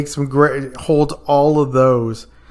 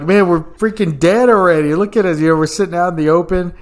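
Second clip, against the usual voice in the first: higher, fast, urgent reproach; exasperated scolding; 5 semitones higher, 3 dB louder, faster than usual. Retold or imitated. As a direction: tell him off in a rush. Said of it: "And I'm like".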